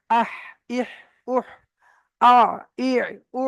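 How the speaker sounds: "আহ, ইহ, উহ, আ, ই, উ" one at a time from mid-throat; throat-letter drill. Shown as a demonstration. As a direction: drill "আহ, ইহ, উহ" breathed out, then "আ, ই, উ" squeezed in the throat. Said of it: "এমন ভাবে practice করতে হবে".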